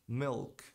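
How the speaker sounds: "Milk" with an audible L; touch the palate for L in "Milk"; pronounced L. Shown as a demonstration. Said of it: "e não milk".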